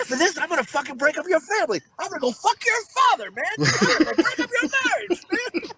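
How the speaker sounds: fast, very high comic tirade; mock threatening rant. Co-host as Slapdog threatening the kid's family, mock rage.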